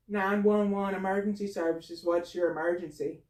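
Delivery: flat official phone manner; lightly voiced emergency operator; 911 operator.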